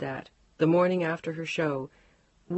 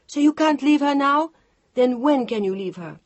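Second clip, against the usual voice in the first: furious outburst; 9 semitones higher, 8 dB louder than usual. Acted. Chantal furious at Mark leaving.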